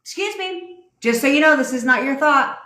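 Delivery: high, chirpy pretend announcement; hammed-up notification voice; mock inner alarm voice. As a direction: play a prim little warning voice in your head. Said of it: "There's no flag that goes up that's like".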